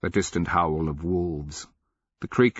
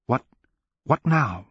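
panicked question; 6 semitones higher than usual. Acted.

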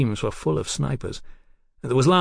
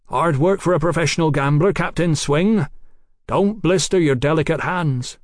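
raised, projected taunt; 5 semitones higher, 4 dB louder than usual. Acted. Doctor mocks the gambler captain below, taunting. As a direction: jeer down at an opponent.

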